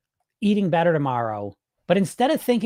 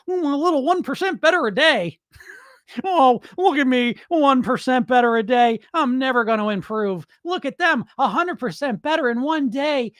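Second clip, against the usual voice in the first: higher, whiny pretend self-pity; mock self-pity; 7 semitones higher than usual. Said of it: "Here I am".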